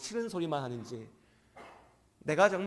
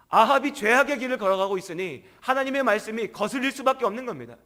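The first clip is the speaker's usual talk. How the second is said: raised, driving sermon voice; fervent preaching; 5 semitones higher, 4 dB louder than usual. Real, not acted. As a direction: press the point home to the congregation. Preaching at full force. (열정적으로 외치는 설교)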